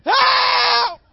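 very high, loud desperate screams; screaming for help. Retold or imitated. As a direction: scream like the plane is going down. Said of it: "I'm screaming".

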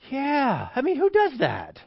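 high scandalized gasp of a gossip. Acted. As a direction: cut in, shocked at the neighbor.

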